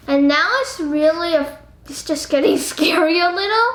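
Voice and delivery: ordinary talk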